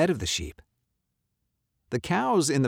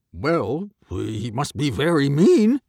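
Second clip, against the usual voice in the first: louder dog character voice; 3 semitones higher, 6 dB louder than usual. A narrator's reading. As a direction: give the dog's firm verdict. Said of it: "Dog decided".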